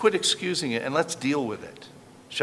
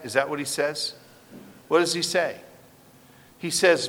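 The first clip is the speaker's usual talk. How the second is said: ordinary talk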